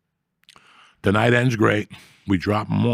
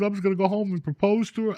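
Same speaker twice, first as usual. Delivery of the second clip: mock tearful, pitched way up; imitating crying; 10 semitones higher, 3 dB quieter than usual. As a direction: whine it out like a heartbroken guy begging. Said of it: "This kid started crying".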